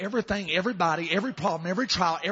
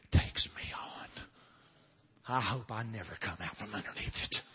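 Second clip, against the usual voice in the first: hushed, low, near-whispered thoughts; weary Elijah; 8 semitones lower, 6 dB quieter than usual. Acted. Elijah giving up under the juniper tree, hushed and weary. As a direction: let it sink away, spent and barely voiced.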